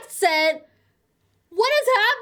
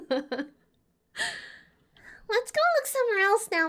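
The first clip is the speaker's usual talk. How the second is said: put-on eager little girl; 5 dB quieter, much faster than usual.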